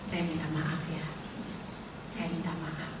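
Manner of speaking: very soft, low humble apology; meek murmured "I'm sorry". Retold or imitated. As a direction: say sorry gently, barely voiced. Retold apology to husband.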